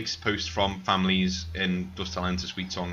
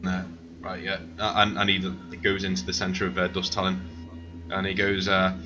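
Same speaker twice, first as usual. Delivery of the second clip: ordinary talk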